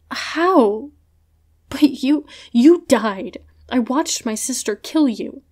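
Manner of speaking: high, loud, stunned; shocked disbelief. Acted. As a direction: gasp it out in disbelief. Shock at dead man alive.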